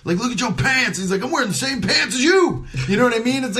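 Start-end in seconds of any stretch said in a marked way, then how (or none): 0.2-1.0 high-pitched heckle, no real volume; mock screaming. Inmates fighting in the rows, mock screaming. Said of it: "but just screaming back". 1.2-2.7 high, strained mock comeback; mock screaming back. Inmate answering another's heckle, mock screaming back. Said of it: "but just screaming back".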